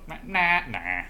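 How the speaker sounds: drawn-out cartoon nah, high then dropping; hammed-up villainous nah; Robbie Rotten. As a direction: stretch out a mocking refusal. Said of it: "you just turn into Robbie Rotten and you go".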